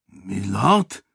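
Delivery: guard asks hesitantly, rising; a wary guard half asking. A narrator's reading. Guard at the gate hesitantly asking the returned lord. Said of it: "fragte der Wächter zögerlich".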